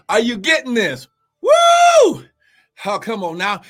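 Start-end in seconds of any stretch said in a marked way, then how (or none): 1.4-2.2 very high, loud whoop of praise; ecstatic whoop. Real, not acted. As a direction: let out a piercing joyful whoop. Preacher's ecstatic exclamation.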